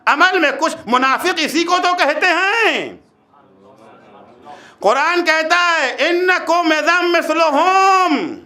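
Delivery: high, impassioned sermon delivery; forceful preaching. Real, not acted. Preacher warning listeners of God's displeasure, forceful preaching. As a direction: drive the challenge home to the congregation.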